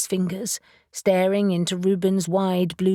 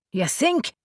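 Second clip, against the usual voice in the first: dry, higher mock question; sarcastic; 5 semitones higher than usual. Reuben mocks Caspian's lesser-evil remark, sarcastic. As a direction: tease the obvious with sarcasm. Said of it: "Reuben said sarcastically".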